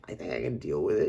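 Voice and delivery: normal talking voice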